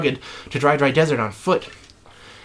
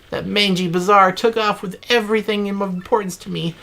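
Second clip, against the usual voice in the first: ordinary delivery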